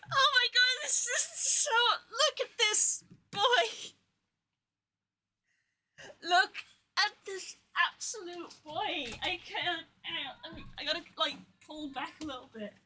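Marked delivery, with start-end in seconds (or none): 0.1-9.1 high, slow, near-tearful gushing. Real, not acted. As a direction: melt over the big plush. Gushing over the plush.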